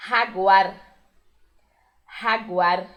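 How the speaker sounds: jaguar with a rough throat J and a tapped final R; Spanish jaguar. Shown as a demonstration. Teacher modelling the Spanish word for Brazilian students, throat J. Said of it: "que a gente chama de jaguar".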